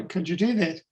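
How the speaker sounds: normal talking voice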